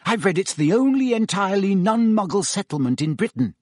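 eager to show what she's read; keen, eager. A narrator's reading. Hermione to Ron, keenly showing off her reading. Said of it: "asked Hermione keenly".

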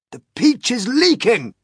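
high, loud shout of warning; old grasshopper shouting the alarm. A narrator's reading. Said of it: "shouted the old green grasshopper".

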